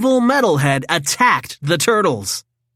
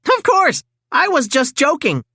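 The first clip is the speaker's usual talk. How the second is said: nervous cover-up; 10 semitones higher than usual. A narrator's reading. Donatello caught staring at April, high flustered excuse. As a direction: laugh it off, caught out. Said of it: "Donatello laughed nervously".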